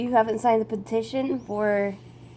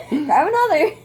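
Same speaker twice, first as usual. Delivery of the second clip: laughing; 6 semitones higher, 6 dB louder than usual. Real, not acted. Laughing mid burping contest.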